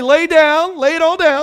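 loud call for the congregation to repeat; preacher's loud call-and-response. Real, not acted. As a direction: lead them in the refrain, loud. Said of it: "everybody say".

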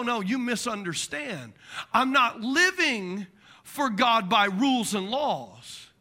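ordinary talk, nothing marked

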